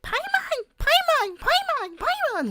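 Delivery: very high, squeaky call of the name; shrill little companion calling out; Paimon voice. Retold or imitated. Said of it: "Paimon. Just like".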